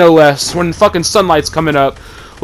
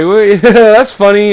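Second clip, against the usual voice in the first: slow, amused chuckle as if it's a joke; laughing disbelief; 5 semitones higher, 5 dB louder, slower than usual. Retold or imitated. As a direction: laugh it off, thinking she's kidding. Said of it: "We're all just like".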